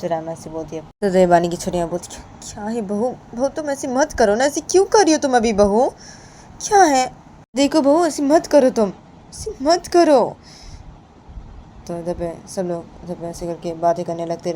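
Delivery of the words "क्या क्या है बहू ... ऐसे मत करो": higher and louder, begging the bride to stop; तेज़ आवाज़ में मनाती चाची; pleading aunt voice. Acted. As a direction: coax her not to do it, voice up. Chachi pleading with the bahu.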